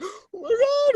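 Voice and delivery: very high, loud acted cry; terrified wail. First-time jumper clinging on the wing, terrified wail. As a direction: wail it in panic.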